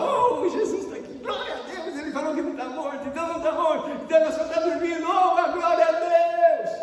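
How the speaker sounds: high, fast excited shouting; mock jubilant crowd. Preacher imagining mourners cheering Jesus's words, mock jubilation. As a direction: gush loudly like an overexcited congregation, building to a shout.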